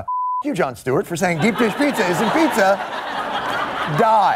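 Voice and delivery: mock-angry reading of a hate tweet; hammed-up indignant outburst; ranting back. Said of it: "were ranting back".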